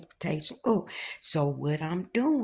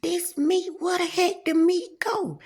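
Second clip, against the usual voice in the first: much higher, bewildered; baffled; 10 semitones higher than usual. Retold or imitated. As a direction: squeak out the confusion.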